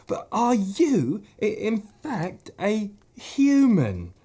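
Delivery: high, slow hesitant fawn; stammering fawn. Acted. Fawn asking Lucy if she's human, high and slow. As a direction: ask each word carefully, wide-eyed.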